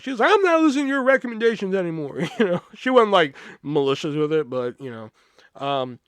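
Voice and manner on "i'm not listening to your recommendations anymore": high, louder huffy retort; mock huffy customer. Customer dismissing the clerk, high and huffy. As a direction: flounce off in a high huff.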